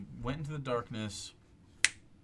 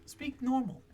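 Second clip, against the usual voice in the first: higher mock flustered voice; mocking the player; 10 semitones higher than usual. GM teasing the player who forgot the accent, mock flustered. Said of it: "he's just like".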